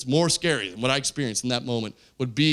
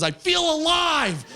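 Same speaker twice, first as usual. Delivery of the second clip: ecstatic; 14 semitones higher, 3 dB louder, much slower than usual. Retold or imitated. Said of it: "all I could think is".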